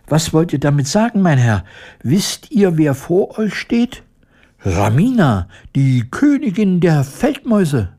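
high, haughty mouse queen; indignant mouse. A narrator's reading. Mouse queen correcting the woodcutter, indignant squeak. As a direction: announce your rank in high-pitched offence. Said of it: "piepste das Tierchen empört".

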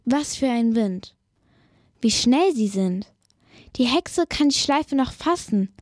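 usual speaking voice

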